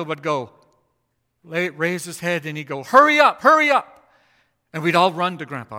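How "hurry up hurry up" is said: high, loud cry of hurry up; an old man suddenly calling out, high and loud; urgent call. Retold or imitated. Grandpa calling from his chair.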